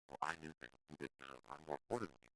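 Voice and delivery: quiet, smug put-down; smug sarcasm. Shown as a demonstration. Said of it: "Using sarcasm".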